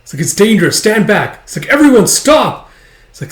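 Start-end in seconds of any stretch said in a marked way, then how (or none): 0.2-1.4 high, loud, urgent warning; shouted warning. Acted. Soldier warns boy away from dragon, shouted. 1.7-2.7 high, slow, loud command; urgent shout. Acted. Boy tells soldiers to stop attacking, urgent shout.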